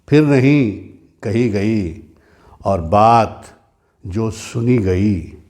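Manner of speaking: slow declaimed verse, rising openings and falling refrains; ghazal recitation. Acted. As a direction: recite each phrase apart, with long pauses.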